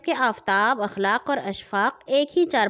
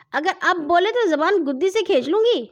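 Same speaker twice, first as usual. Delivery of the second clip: higher, louder mock threat; angry threat; 8 semitones higher, 4 dB louder than usual. A narrator's reading. Sister threatening the interrupting brother, angry. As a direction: threaten the boy angrily, pitched up. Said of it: "باجی نے غصے سے کہا".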